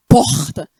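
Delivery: loud Rio "porta" with a strong, drawn-out R; Rio accent. Shown as a demonstration. Preacher showing how people talk in Rio, "porta" with strong carioca R. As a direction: push the R in "porta" hard, carioca style. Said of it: "é um R forte".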